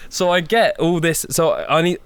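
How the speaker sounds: ordinary talk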